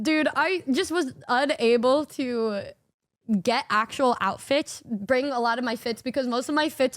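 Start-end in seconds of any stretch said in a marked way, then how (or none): none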